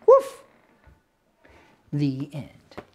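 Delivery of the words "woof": high yappy woofs; puppy barking. Acted. Storyteller voicing Biscuit answering the girl, high puppy barks. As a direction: bark like a tiny puppy.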